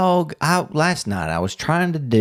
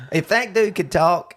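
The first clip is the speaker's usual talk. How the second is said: ordinary delivery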